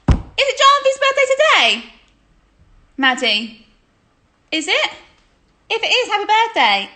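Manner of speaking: high, loud birthday shout-out; loud, high-pitched greeting. Real, not acted. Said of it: "There's a shout out".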